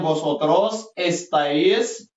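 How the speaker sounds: slow, drawn-out teacher modelling; slow rising list, like reading for the class; slow model drill. Shown as a demonstration. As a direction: stretch each form out for learners to copy. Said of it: "Sumaya يسمع مزيان".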